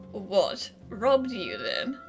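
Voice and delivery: ordinary talk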